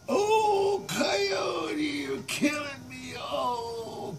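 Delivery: high, loud agonized cry; dying monster wail. Acted. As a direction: wail in hammed-up agony.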